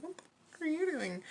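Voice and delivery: soft, high-pitched incredulous question; mock disbelief. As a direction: voice the baffled family, rising high and quick. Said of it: "They're like".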